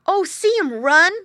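put-on high, loud boyish exclamation; excited cry. A narrator's reading. Boy watching pig flee, crying out. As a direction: cry out with delight. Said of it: "cried the boy".

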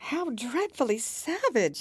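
high, drawn-out dismay; shocked exclamation. A narrator's reading. Alice hearing of the Queen's order, shocked. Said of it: "exclaimed Alice".

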